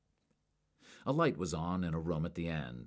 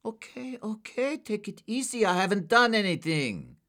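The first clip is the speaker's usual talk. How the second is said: much higher, rising into fear; panicky pleading; 8 dB louder than usual. A narrator's reading. Suspect pleading with an armed agent, panicky. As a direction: plead fast and scared.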